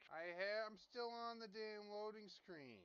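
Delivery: high-pitched, drawn-out complaint; exasperated yelling. Real, not acted. Answering friend about the game loading, exasperated yell. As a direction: protest slowly and high, with real exasperation. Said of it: "You don't have to yell at me".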